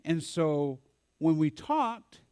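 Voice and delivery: ordinary delivery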